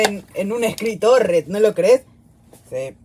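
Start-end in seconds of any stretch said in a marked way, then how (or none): none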